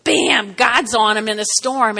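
normal talking voice

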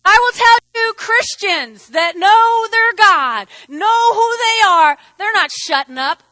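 loud, slowed, emphatic preaching; fervent preaching. Real, not acted. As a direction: drive every word home at full force. Preacher builds to climax.

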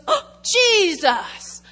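high, loud, slow, drawn-out cry of delight; delighted God. Acted. God looking at a believer and seeing Jesus, high and loud in delight. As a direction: gasp it out in wonder, stretching every sound. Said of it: "and he goes".

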